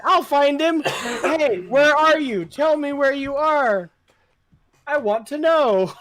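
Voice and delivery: put-on goofy kid. Retelling the silly scene, high laughing kid voice. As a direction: ham up a clueless kid. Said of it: "it's like".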